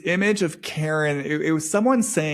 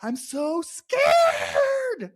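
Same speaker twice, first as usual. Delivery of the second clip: much higher, sobbing shout, the last words dragged out very slow; crying scared shout. Acted. Channeling Karen's terror, crying high shout to viewers. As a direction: wail it like a terrified little girl. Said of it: "what I hear Karen saying".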